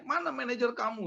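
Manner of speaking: high, indignant re-enacted demand; retold angry demand. Angry husband demanding the teller's manager, indignant. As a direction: demand it as if losing your temper. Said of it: "wah saya emosi gitu loh".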